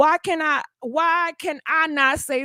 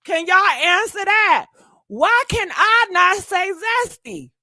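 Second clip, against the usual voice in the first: louder, higher challenge to the chat; demanding shout; 4 semitones higher, 4 dB louder than usual. Real, not acted. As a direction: call them out loudly.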